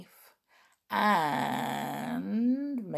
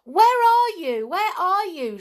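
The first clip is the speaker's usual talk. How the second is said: much higher, loud yell; 12 semitones higher, 9 dB louder than usual. Acted. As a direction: yell across the room. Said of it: "she yelled".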